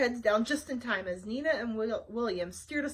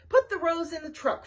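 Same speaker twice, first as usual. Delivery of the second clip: bossy order; 7 semitones higher, 6 dB louder than usual. Acted. Villain Mimi orders Flytrap.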